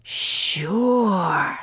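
slow, low snake whisper; hushed, drawn-out serpent voice. Acted. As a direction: breathe it out like a sly snake. Snake answers Frog.

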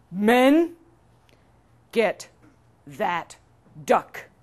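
loud, drawn-out order with big pauses; king's command. Acted. King orders soldiers to seize the duck, loud command. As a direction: call out "Men", then space every word.

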